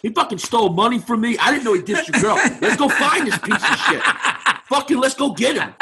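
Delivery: high-pitched rallying, laughter creeping in; rally them with put-on outrage; mock indignation. Cornered man urging the gang to hunt his friend, mock-indignant. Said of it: "I flipped".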